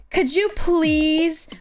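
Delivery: drawn-out, put-on begging; mock pleading. Artist mimicking client's autograph request, mock pleading. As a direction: imitate a wheedling request, stretched slow.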